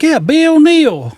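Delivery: put-on high, slow amused old lady; imitating the store lady drawing out Bill Neal. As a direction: savour the rhyme sweetly. Old lady stresses rhyme.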